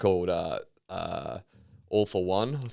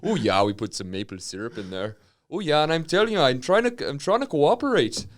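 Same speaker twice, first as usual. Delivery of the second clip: higher, hammed-up Fargo accent; 6 semitones higher, 3 dB louder than usual. Co-host drifts into a Fargo character, Fargo accent. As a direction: slide into pleading Fargo dialect. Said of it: "That's Fargo".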